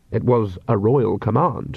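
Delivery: plain reading voice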